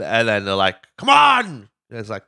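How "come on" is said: loud, high yell of urging; exasperated high-pitched shout; impatient mock shout. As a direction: holler it at someone holding everyone up.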